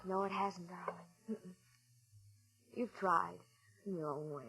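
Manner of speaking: soft, low reassurance; wife softly comforting her down husband; tender comfort. Acted. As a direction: soothe him gently.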